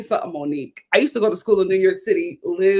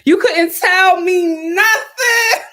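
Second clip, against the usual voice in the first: loud; 8 semitones higher, 4 dB louder, much slower than usual. Shown as a demonstration. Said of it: "repeated louder".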